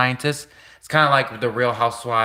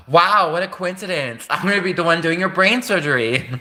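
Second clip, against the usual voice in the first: mock valley girl; 6 semitones higher than usual. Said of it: "She's like".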